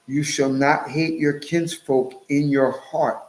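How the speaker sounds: ordinary talk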